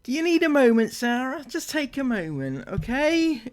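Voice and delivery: hammed-up soothing of a crying child; mock-consoling a vlogger who teared up; mock comforting. Mocking comfort of tearful Sarah.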